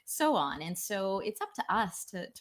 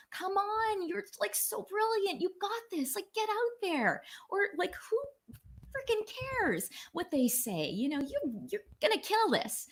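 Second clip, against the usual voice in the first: much higher, bright cheerleading voice; encouraging parent; 13 semitones higher than usual. Retold or imitated. Parent cheering on a child, much higher and excited. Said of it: "energy and encouragement".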